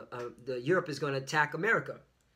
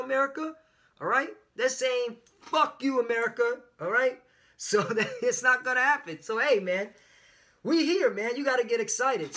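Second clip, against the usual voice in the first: raised, higher preaching burst, building heat; fired-up preaching; 8 semitones higher, 4 dB louder than usual. Real, not acted. Street preacher roaring at America about Europe, fired-up preaching. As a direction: whip the audience up like a street preacher.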